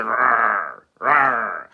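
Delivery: low, growled rawr like a hungry lion; a mock lion roar from an empty belly; stomach lion growl. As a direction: growl low to play his rumbling stomach. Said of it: "y'all hear".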